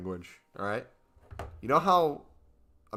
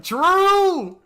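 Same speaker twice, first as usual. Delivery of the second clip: a sudden high, loud cry of true; emphatic shout; 11 dB louder than usual. Real, not acted. Correcting the chatter, a loud high insistent true.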